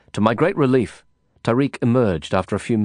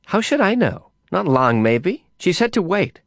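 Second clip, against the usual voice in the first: a hint of an offhand woman, higher; lightly voiced woman; 9 semitones higher than usual. A narrator's reading. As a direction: voice her higher, a bit dismissive. Other character answers, woman.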